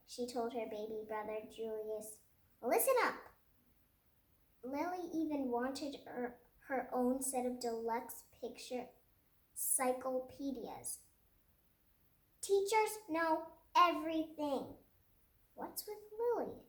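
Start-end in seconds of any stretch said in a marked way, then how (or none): 2.6-3.2 higher, louder bossy call; playing teacher. Lily playing teacher to baby brother, higher and louder. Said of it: "Lily pretended to be Mister Slinger". 12.4-14.8 bossy teacher. Pretend. Lily lecturing brother. 15.5-16.6 puzzled mother. Acted. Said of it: "asked her mother".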